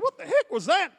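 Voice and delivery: high, loud baffled question; bewildered. Retold or imitated. The stranger reacts to Wigglesworth, high baffled outburst. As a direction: gape at what just happened.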